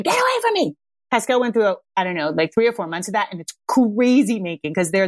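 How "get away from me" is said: mock scream. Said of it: "they scream".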